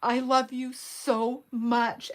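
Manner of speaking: loud, slow, over-the-top gushing; hammed-up loving text, drawn out and loud; exaggerated gushing. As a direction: read it out like a gushing text message. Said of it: "like, exaggerated".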